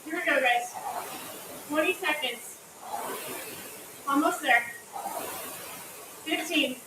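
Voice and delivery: upbeat gym coach calling out; coach cheering. Acted. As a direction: pump up the class, counting down. Coach cheering through a workout.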